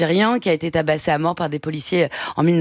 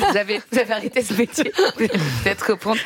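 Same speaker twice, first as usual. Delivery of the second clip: talking through laughter; amused; 2 semitones higher, faster than usual. Real, not acted. Host teasing a guest who gave up writing poems, amused. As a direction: laugh while getting the words out.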